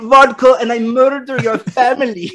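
mocking the Russian villain in a thick, over-the-top Russian accent. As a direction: ham up a cartoon Russian bad guy accent. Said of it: "whose accent is amazing".

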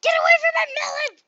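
high, loud, fast shout to protect the melon; panicked shout. Real, not acted. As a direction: yell at the mob to get off. Mob near her melon.

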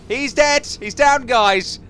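loud, high victory yell; triumphant shout. Real, not acted. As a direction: announce the kill to the squad. Triumphant call to the team.

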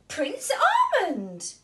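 a touch of glad surprise; surprised cry. Acted. Fairy surprised to see the lost prince. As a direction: recognize him with delight. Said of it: "cried the Sugar Plum Fairy".

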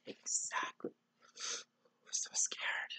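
hushed, fearful whisper; scared whisper. Acted. Reader sharing hiding Eddie's fear with a child, scared whisper. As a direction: whisper it slowly, very soft.

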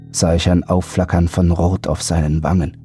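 ordinary delivery

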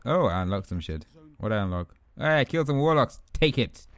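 usual speaking voice

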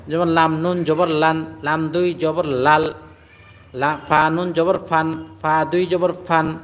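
লান and ফান said slowly, ending in n; slow and even, ending on n; tanwin like nun sakin. Shown as a demonstration. Teacher comparing tanwin with nun sakin: slow লান, ফান. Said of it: "নুন সাকিনের মতো হয়".

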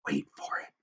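soft, low whisper; barely voiced, hushed warning; hushed squirrel. A narrator's reading. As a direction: whisper it to hold them back. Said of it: "said the squirrel".

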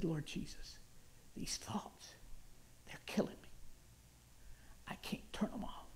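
very soft, halting whisper; hushed plea. Retold or imitated. Desperate man telling Jesus about his thoughts, hushed and halting. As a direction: barely get it out, pausing between phrases.

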